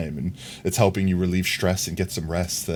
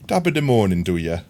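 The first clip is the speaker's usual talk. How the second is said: hammed-up fake Irish greeting; mock Irish leprechaun accent; playful fake Irish accent; bad Irish accent; 4 dB louder than usual. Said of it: "I don't know what that accent was".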